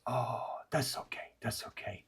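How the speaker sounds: hushed, breathy reassurance; whispered creature reply. Acted. Steam creature letting Jerry off the hook, whispered. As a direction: whisper it kindly.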